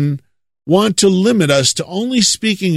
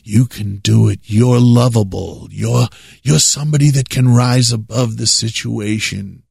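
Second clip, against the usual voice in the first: lower, honeyed mock encouragement; mock positive preacher; 6 semitones lower than usual. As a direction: send up a feel-good preacher in a low voice. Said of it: "he would be all this positive".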